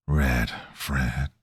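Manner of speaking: low, slow, half-whispered wheeze; raspy chain-smoker voice. A narrator's reading. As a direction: rasp it out like a lifelong smoker. Stranger names him.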